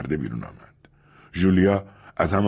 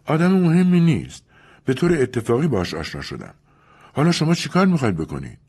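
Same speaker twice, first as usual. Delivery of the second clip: higher, quick and nervous; nervous Julia; 9 semitones higher, 4 dB louder than usual. Acted. Julia to Louis, nervous and quick. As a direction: rush past the question. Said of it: "ژولیا عصبی دست تکان داد".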